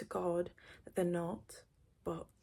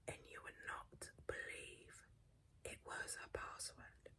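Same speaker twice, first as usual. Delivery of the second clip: barely voiced whisper, much softer; dramatic hush; 15 dB quieter than usual. Real, not acted. Revealing the shocking password to viewers, in a whisper.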